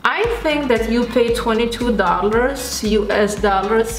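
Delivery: speaking through mask mouth hole; talking while pressing mask on; wearing a face mask. Real, not acted. Seen 2: Thick full-face mask worn throughout; mouth opening leaves lips uncovered.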